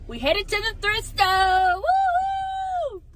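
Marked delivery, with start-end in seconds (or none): 1.7-3.0 excited whoop. Real, not acted. Heading to the thrift store.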